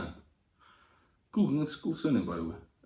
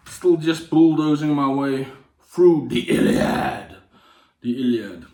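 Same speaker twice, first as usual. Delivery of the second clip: slow strained drawl; slower than usual. Pretend. Stretched, strained delivery.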